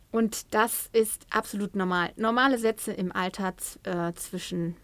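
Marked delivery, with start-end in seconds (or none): none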